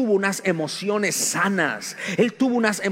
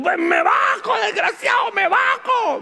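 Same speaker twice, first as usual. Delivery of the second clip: grito de rabia muy agudo y fuerte; grito furioso; 4 dB louder than usual. Pretend. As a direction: responde al que te insulta a gritos. Respuesta furiosa imaginada. (respuesta furiosa al que se burla)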